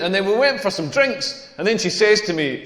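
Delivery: ordinary talk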